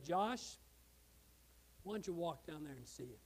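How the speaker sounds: very soft, low voice of the Lord; hushed, low retold call; gentle divine prompting. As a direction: speak low and quiet, a private nudge from God.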